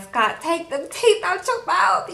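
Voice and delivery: high, slow, put-upon plea to Scott; exasperated call. Retold or imitated. Viewer mocking a reality star to his face, high and slow. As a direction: draw it out high like you can't believe him. Telling Scott to remove teeth.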